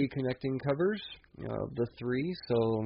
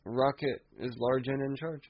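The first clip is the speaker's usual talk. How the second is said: ordinary talk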